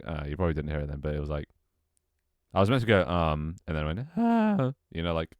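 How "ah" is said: sudden high falsetto squeak; voice crack. Retold or imitated. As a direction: squeak it two octaves up. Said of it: "two octaves too high".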